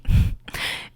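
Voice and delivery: breathy mocking chuckle; whispered laugh. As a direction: laugh under your breath at them. Mocking the caller's coverage.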